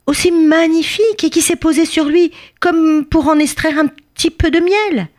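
high, flattering small-creature voice; pleading little bee. Acted. Tiny bee excusing herself to the king, pleading.

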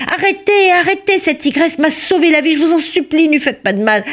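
crié très haut et plus fort, implorant; cri suppliant. Acted. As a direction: hurle aux chasseurs d'arrêter. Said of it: "il criait". (le bûcheron crie aux chasseurs, suppliant et aigu)

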